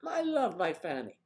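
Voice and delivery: high, loud mock greeting across a square; calling out to a friend. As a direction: call out cheerfully to a friend named Fanny. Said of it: "and I say, say".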